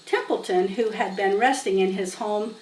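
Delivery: plain reading voice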